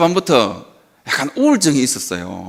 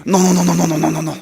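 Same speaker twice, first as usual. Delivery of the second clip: rapid-fire string of refusals, run together; comic panicked babble; mock agonised protest; much slower than usual. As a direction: imitate a patient protesting in pain. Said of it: "죽는다는 거예요".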